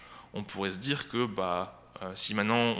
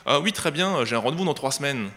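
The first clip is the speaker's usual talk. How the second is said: higher, louder, breezy receptionist on the phone; dentist's receptionist brightly offering a far-off appointment, higher and louder; chirpy receptionist; 5 semitones higher, 6 dB louder than usual. Pretend. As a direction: sing it out like a cheerful front desk. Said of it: "vous téléphonez chez le dentiste".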